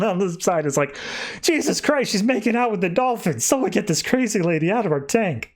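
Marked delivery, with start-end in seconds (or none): 1.4-5.5 much higher, aghast exclamation; shocked outcry. Retold or imitated. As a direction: gasp it out in mock horror. Onlookers shocked at dolphin kiss.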